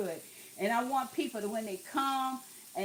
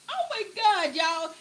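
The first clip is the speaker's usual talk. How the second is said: higher and louder, bursting with delight; excited gushing; 6 semitones higher, 5 dB louder than usual. Real, not acted. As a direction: rave about how good it smells. Raving about the brine smell.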